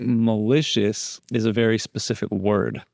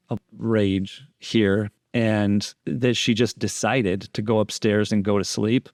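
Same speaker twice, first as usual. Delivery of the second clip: ordinary talk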